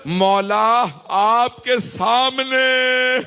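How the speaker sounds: slow, drawn-out mourning appeal rising in pitch; majlis lament. Acted. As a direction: plead to Abbas, stretching each word in grief. Addresses Abbas in lament. (کھنچی ہوئی دردناک فریاد)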